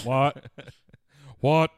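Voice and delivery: loud, punchy wrestler catchphrase; Stone Cold. Acted. Friend echoing the movie line, loud wrestler impression. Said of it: "Stone Cold was in that scene".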